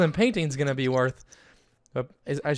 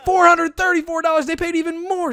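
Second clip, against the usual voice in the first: much higher, louder, delighted over the sale price; thrilled; 13 semitones higher, 7 dB louder than usual. Real, not acted. Player to viewers on a painting sale, high excited shout. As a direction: cheer the big sale as it comes in. Painting sells for big money.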